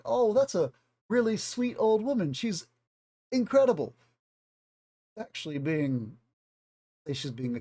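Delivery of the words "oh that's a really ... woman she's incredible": higher-pitched, gushing praise; naive admiration. Acted. Outsider charmed by the sweet old woman, high-pitched gushing. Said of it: "you might think".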